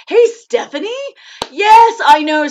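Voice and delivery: loud, high burst of delight; excited greeting. Real, not acted. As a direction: call out brightly to a friend just spotted. Greets viewer joining the live chat.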